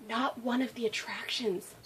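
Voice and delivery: whispered aside; whispering. Real, not acted. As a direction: lean in and whisper it.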